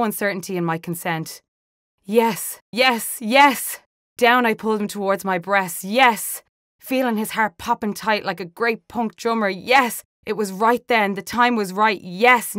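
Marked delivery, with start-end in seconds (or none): 2.0-12.6 building passion. Acted. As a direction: let the joy surge through each yes. Passionate climax of retold consenting encounter.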